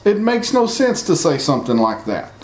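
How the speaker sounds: ordinary talk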